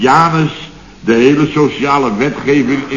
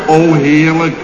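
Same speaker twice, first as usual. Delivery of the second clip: lightly voiced polite delight, a bit louder; forced delight; 4 semitones higher, 5 dB louder, slower than usual. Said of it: "dan zeg ik". (gedwongen beleefde verrukking)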